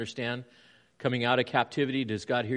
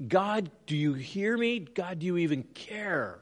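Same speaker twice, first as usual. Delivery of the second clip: higher, slow pleading cry to God; a hint of a desperate prayer; desperate plea; 6 semitones higher than usual. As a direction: plead as if at the end of your rope. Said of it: "crying out to God".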